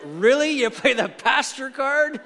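slow, disbelieving challenge to a friend; mock disbelief.

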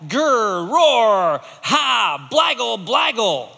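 loud, growling monster noises; monster roar. Acted. Teacher roaring like a monster for the class. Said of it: "'Cause that's what monsters say".

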